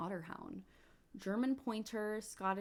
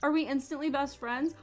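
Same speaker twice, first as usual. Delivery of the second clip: high, loud, quick question rising at the end; excited question; 5 semitones higher, 7 dB louder, faster than usual. Real, not acted. Player to her new game puppy, excited question. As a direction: ask it eagerly, pitched up.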